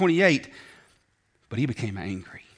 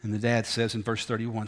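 ordinary delivery

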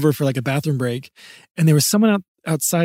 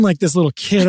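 ordinary talk, nothing marked

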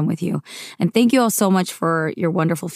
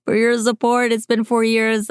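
higher voice, close to tears after a laugh; thanking Marv, fighting back tears; getting emotional; 5 semitones higher than usual. Real, not acted. Host thanking her engineer, voice catching with emotion. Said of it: "before I start crying".

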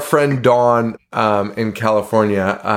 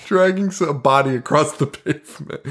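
normal talking voice

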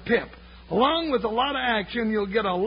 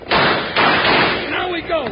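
loud, slow rallying cry; drawn-out battle call; rallying shout; 3 semitones higher, 8 dB louder, much slower than usual. Acted. Charge begins on horseback.

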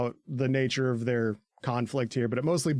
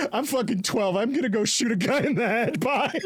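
put-on bratty kid voice; 9 semitones higher than usual. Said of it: "Gabi's like".

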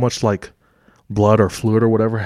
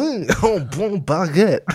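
mock French; 10 semitones higher than usual. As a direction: drag out a silly high French "bon baguette". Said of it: "What's your best French accent".

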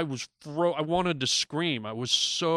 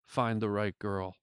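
softer and slower, a hushed, deliberate prophecy; lower, quiet and slow, like a parting word from beyond; quiet, slow warning; 6 semitones lower, 7 dB quieter than usual. Retold or imitated. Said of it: "looked down at me and said".

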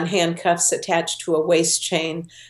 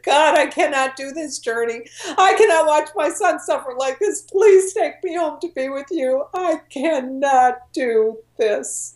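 high, strained cry to God, retold; anguished plea; 7 semitones higher than usual. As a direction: relive a desperate prayer, pitched high and slowing at the end. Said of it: "hearing this guttural wail".

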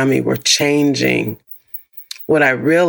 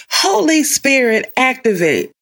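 high, calling Holy Spirit prayer; TikTok prayer; 10 semitones higher than usual. Pretend. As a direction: call it out like a preacher. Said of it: "that thing that, what's on TikTok".

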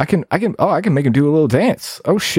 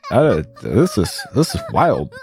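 ordinary talk, nothing marked

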